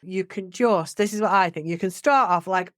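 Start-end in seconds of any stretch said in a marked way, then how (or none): none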